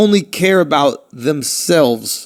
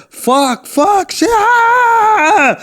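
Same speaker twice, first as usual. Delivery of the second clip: imitated scream; 13 semitones higher, much slower than usual. Imitating girlfriend startled by a jump scare, screaming. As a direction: build from high yelps into a drawn-out mock scream. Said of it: "she screamed at the top of her lungs".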